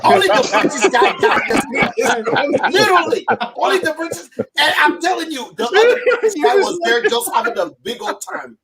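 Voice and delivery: high-pitched, talking through laughter; helpless laughter pushing the voice way up; cracking up. Real, not acted. Comic tickled by his own British joke, cracking up.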